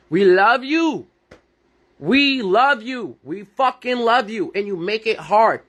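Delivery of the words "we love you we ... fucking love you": raised, drawn-out earnest plea; heartfelt insistence, stretched out; impassioned plea. Real, not acted. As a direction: plead it slowly, pressing each repetition. Earnest repeated plea to women.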